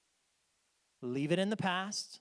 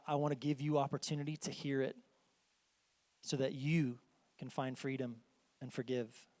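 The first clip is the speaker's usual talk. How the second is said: usual speaking voice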